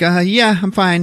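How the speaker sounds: high-pitched, unconvincing reassurance; anxious cover-up. Retold or imitated. Anxious woman answers from the kitchen, mock high-pitched reassurance. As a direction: imitate someone pretending to be fine. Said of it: "she gets, like, anxiety".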